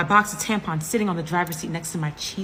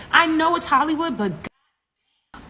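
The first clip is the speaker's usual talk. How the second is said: high, louder exasperated outburst; exasperated complaint; 9 semitones higher, 4 dB louder, slower than usual. Retold or imitated. Exasperated complaint to Uber driver.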